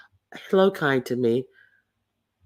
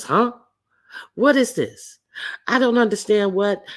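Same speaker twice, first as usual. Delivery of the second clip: higher, confused Huh? What is this?; imitating a baffled girlfriend; baffled reply; 7 semitones higher than usual. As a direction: play her as lost and puzzled. Mimics Brooke's confused reply.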